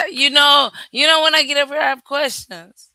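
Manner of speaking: loud laughing burst into words; laughing loudly. Real, not acted. Laughs, then talks loudly and high.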